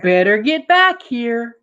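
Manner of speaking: high, loud call across the yard; mother calling out. Acted. Mother calling the children back for cake. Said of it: "mother yelled".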